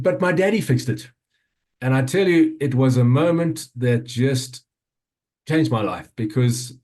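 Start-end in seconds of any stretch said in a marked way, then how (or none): 0.0-1.1 put-on little girl voice. Dad voices toddler daughter's reply.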